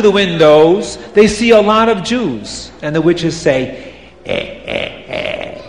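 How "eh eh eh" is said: low gleeful croaking grunt; witch croak. Acted. Witches spotting a crowd, croaking low with glee.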